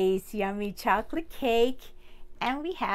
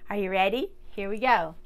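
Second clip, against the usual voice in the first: ordinary delivery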